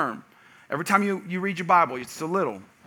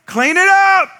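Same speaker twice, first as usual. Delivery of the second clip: put-on high, loud yell at the kids; parent's scream; 12 semitones higher, 8 dB louder, slower than usual. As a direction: holler an order across the house. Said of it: "You scream at 'em".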